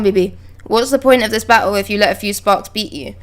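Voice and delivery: ordinary delivery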